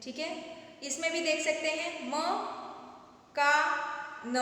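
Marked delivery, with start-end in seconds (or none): 2.1-4.4 मकान split as म, का, न; syllable by syllable. Shown as a demonstration. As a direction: sound out मकान one syllable at a time. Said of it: "ध्यान से और अच्छे से पढ़ें".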